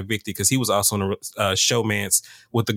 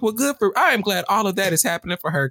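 raised, mock-pleased approval; sarcastic, pitched up; sarcastic high; 8 semitones higher than usual. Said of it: "I was like".

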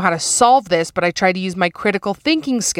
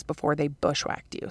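whisper; 3 semitones lower, 10 dB quieter than usual. Acted. Host teasing about hairy hoo-has.

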